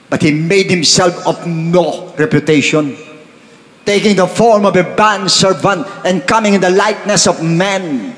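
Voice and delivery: slow, high, solemn verse reading; scripture reading. Acted. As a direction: lift the Bible verse up slowly and solemnly. Reads scripture aloud. (mabagal at mataimtim na pagbasa ng talata)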